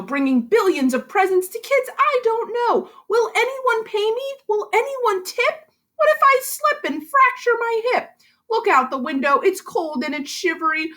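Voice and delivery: put-on lively rhyming read-aloud for kids; story narration. A narrator's reading. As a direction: read it like a bouncy bedtime rhyme. Teacher reads picture book aloud.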